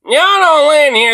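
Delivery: high-pitched mock of a nosy neighbor; mocking mimicry. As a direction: parrot the complaining neighbor, high and exaggerated. Mimics woman from the played clip.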